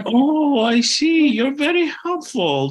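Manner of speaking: put-on high, loud, genuinely grateful older lady; sincere older lady. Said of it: "she said it in a such a sincere and genuine way".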